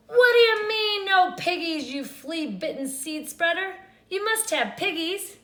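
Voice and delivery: high, loud desperate cry; loud, high desperate witch wail. A narrator's reading. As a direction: wail in frustration. Witch cries out.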